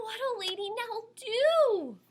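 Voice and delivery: ordinary delivery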